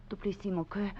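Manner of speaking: hushed whisper to her lover. Acted. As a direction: breathe the words softly.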